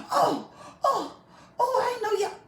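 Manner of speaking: high, laughing fake surprise; mock innocence. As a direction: play the innocent pastor, mocking. Mocking pastor's feigned innocence.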